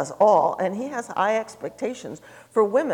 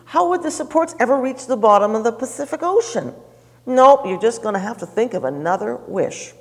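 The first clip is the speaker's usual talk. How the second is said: genie; 5 semitones higher, 7 dB louder than usual. Acted. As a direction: laugh off the idea as the genie. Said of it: "The genie laughed and he said".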